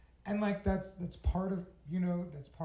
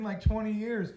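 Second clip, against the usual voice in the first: ordinary talk, nothing marked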